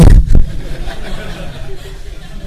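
high breathless giggle; short high embarrassed giggle; embarrassed laugh. Real, not acted. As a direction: laugh it off, a bit awkward. Embarrassed over the wife's accident.

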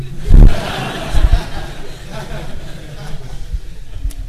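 breathy rising laugh; long airy chuckle; sheepish laugh. Real, not acted. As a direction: laugh at your own joke. Jokes he's not that stupid.

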